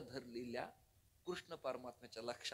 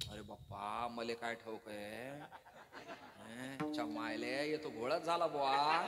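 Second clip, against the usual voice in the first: a light Vidarbha dialect, chuckling; 3 semitones lower, 4 dB louder, slower than usual. Retold or imitated. Preacher imitating a Vidarbha villager. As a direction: drawl it Vidarbha style with a laugh. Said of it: "विदर्भात तुमच्या तिकडे पुढे गेलं".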